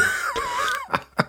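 high, squealing fit of laughter. Real, not acted. Friend amused by the dubbing demo, laughing hard. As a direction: laugh helplessly in high bursts.

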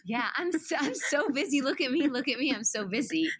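high, sing-song show-off; mock bragging. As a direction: parody someone showing off how busy they are. Playing along, parody of showing off.

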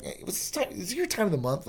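high, hushed mock tease; a sly whispered jab; joking tease. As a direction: needle her quietly, just kidding. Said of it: "Like, just joking".

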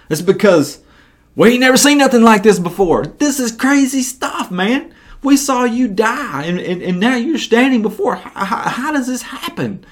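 high, excited disbelief with a laugh; bewildered disciples. Retold or imitated. Disciples' bewildered reaction voiced.

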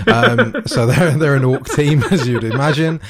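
talking through laughter; giggling while explaining; laughing. Real, not acted. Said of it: "I just felt inspired".

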